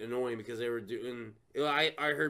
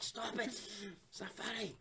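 daughter's protest; 6 semitones higher, 13 dB quieter than usual. Retold or imitated. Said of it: "She's like".

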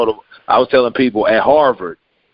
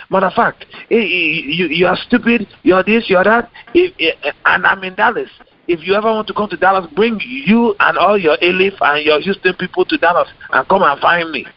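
put-on high-pitched irate Nigerian accent; 8 semitones higher than usual. Guest reads his critic's threat to meet in Dallas, Nigerian accent. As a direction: scold and challenge in a heated Nigerian accent. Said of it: "I would say it in the Nigerian accent".

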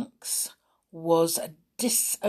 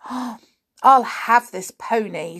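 eager choice; 3 semitones higher, 5 dB louder, slower than usual. Acted. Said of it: "said Molly".

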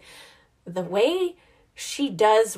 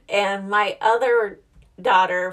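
normal talking voice

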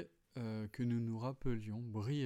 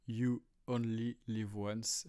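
slow, hammed-up English accent on the English phrase; slower than usual. Shown as a demonstration. Teacher mocking his own English accent for his audience. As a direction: put on a comic English accent, drawing the words out. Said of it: "merci pour mon accent anglais".

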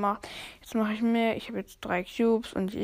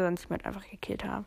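whispering; 8 dB quieter, much faster than usual. Real, not acted. Quick aside after a kill.

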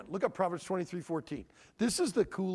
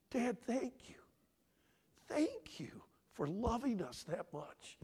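whispered, choked thanks to his father; tearful whisper; 4 semitones higher, 6 dB quieter than usual. Retold or imitated. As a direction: breathe it out like a grateful son holding back tears. Son's tearful thanks, retold.

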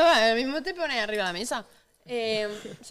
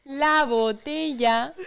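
drawn-out syllables, slightly raised pitch; slow and deliberate, higher; 4 semitones higher, slower than usual. Real, not acted. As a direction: say it very slowly, pitched up for a lip-reader.

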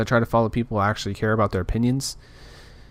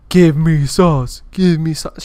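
slow, loud mocking demand, higher pitched; mock entitled yell; 5 semitones higher, 8 dB louder, slower than usual. Entitled customer yelling at McDonald's workers, slow loud mock demand. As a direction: sneer out a whiny customer demanding sauce. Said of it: "And you're in there yelling".

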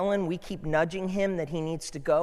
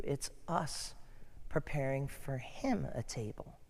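whispering; 2 semitones lower, 10 dB quieter than usual. Real, not acted. Intimate point in sermon.

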